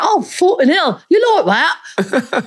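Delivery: high, exclaiming put-on Cockney; Cockney accent. Shown as a demonstration. Student talking back at university, high hammed-up Cockney accent. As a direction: burst out in broad Cockney. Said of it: "a Cockney accent".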